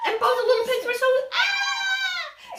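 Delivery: high, loud mock scared squeal; mock scream. Storyteller acting the pigs' fright, loud.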